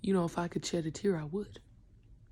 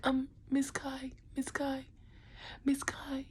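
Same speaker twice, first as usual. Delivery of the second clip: put-on kid voice; 8 semitones higher, slower than usual. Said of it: "They'd be like".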